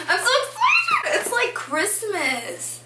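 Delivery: high-pitched squeal of joy; squealing excitement. Real, not acted.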